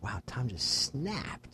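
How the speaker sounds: slow, hushed whisper of disbelief; stunned whisper. Retold or imitated. Friend reacting to his sudden outburst, stunned whisper. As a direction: whisper it slowly, as if taken aback.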